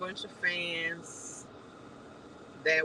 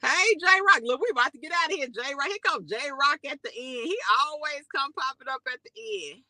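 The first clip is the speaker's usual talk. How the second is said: calling out; 7 semitones higher than usual. Real, not acted. Calling out to viewer.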